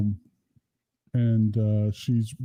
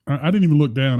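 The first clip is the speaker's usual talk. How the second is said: ordinary talk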